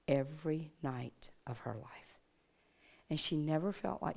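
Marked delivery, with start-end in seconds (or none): 0.1-2.2 softer, unvoiced whisper. Preacher retelling the girl's abuse to the room, whisper. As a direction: let the words fall to a whisper.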